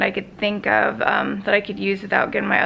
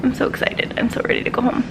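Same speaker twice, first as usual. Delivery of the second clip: excited whisper; faster than usual. Real, not acted.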